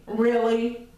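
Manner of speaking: plain reading voice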